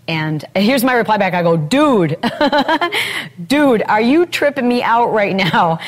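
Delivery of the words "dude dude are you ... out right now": laughing. Retold or imitated. Laughing reply to a friend.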